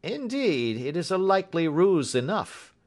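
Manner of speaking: high-pitched, musing inspector; mildly pondering character voice; thoughtful. A narrator's reading. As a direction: concede it as a fresh thought. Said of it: "observed Bradstreet thoughtfully".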